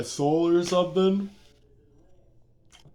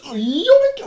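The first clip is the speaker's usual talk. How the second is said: high, slow, louder yoink; yoink sound effect; 8 dB louder than usual. Retold or imitated. Witch snatching and hurling the staff, high drawn-out yoink effect. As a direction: stretch out a cartoon yoink.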